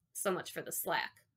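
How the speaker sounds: ordinary delivery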